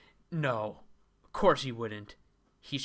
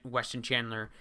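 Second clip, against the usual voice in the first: ordinary delivery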